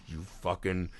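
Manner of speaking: seething curse. Retold or imitated. Inner voice cursing at husband.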